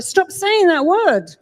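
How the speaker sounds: a therapist scolding a client, loud and stern; firm telling-off. Retold or imitated. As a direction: cut the client off sharply. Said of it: "I'm like".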